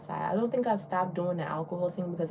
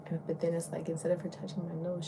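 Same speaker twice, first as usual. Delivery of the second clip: usual speaking voice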